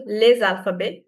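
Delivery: French les alphabets, T left off; silent T in alphabets. Shown as a demonstration. Teacher models for class to repeat, silent T in alphabets. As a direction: say alphabets with no final T. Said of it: "We don't pronounce the T".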